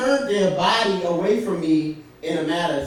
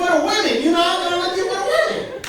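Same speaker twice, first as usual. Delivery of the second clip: high laughing through the words; giggling embarrassed admission; 5 dB louder, faster than usual. Real, not acted. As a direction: crack up while confessing.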